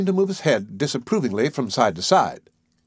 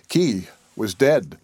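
plain reading voice

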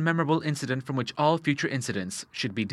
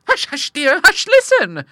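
high, loud urgent hushing; charitable old sister; 10 dB louder, slower than usual. Acted. Bertha hushing Monica, high and urgent.

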